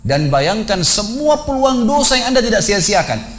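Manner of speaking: high, insistent preaching voice; stern exhortation. Retold or imitated. Confronts the mock corpse with sins.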